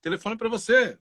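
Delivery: high calling-out voice across the street. Retold or imitated. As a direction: yell up to a neighbor that the phone is for them. Calling a neighbor to phone.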